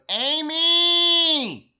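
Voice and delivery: calling a name upstairs, very loud and high; loud yell. A narrator's reading. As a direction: bellow her name up the stairs to wake her. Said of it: "yelled as loudly as he could".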